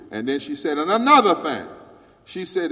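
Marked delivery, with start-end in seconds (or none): 0.8-1.6 louder, building emphasis; loud retold emphasis; emphatic. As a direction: gear up for the next point. Lady's retold speech.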